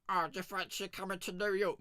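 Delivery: high, put-on squeaky voice for the brother; a high goofy voice, just for a noise; silly made-up voice. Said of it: "I just fancied making a noise".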